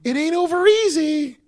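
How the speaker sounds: high squeaky Humpty Dumpty impression. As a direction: squeak it out like a cartoon egg. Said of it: "He's like".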